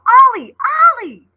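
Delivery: high, very loud yell of a name; urgent distant yell. Acted. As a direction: yell to be found across the corn. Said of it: "Someone yelled".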